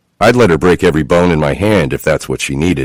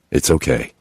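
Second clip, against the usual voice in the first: gentle reassurance; 4 semitones lower, 6 dB quieter than usual. A narrator's reading. Comforting wife gently.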